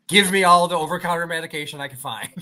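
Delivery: laughing through the words; a laugh running under a pitch-up joke. Real, not acted. Host laughing to co-host about cold medicine.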